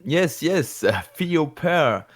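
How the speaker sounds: put-on hesitant Franglais with a thick French accent; French-accented English. Igor shyly asking Jotaro about kissing, French accent.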